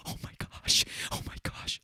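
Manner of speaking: mock excited whisper; whispering camera person. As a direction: whisper it like a camera operator trying to stay hidden. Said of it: "the camera person's like".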